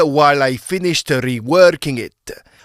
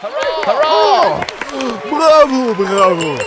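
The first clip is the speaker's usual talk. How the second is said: excited cheering; 10 semitones higher than usual. Acted. Cheering the newlyweds at the party, excited and high. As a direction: cheer the happy couple from the crowd.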